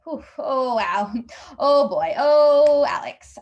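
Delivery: long drawn-out, loud sighing exclamations; stalling for time. Acted. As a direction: stretch each word while you think. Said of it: "I'm panicking and buying time".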